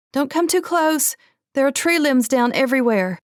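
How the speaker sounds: high, loud warning shout; yelling warning. A narrator's reading. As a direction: wave them off with a yell.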